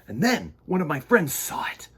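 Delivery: put-on gentle read-aloud to children; story narration. A narrator's reading.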